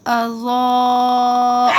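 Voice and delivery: اضاء with a very long level "zoo" cut off at the end; elongated madd. Shown as a demonstration. Teacher stretching Zaad for learners, elongated. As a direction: hold the middle vowel of اضاء on one note. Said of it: "کھینچیں گے تھوڑا زیادہ".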